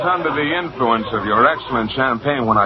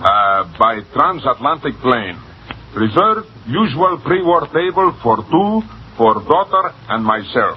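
put-on Russian accent. Young American reading a cablegram on request, Russian accent. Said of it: "A Russian accent".

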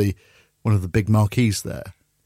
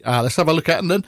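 put-on quick, higher West Country accent; 5 semitones higher, faster than usual. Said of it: "that's how the Wurzels speak".

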